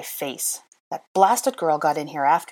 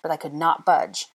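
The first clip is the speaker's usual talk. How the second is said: ordinary delivery, nothing marked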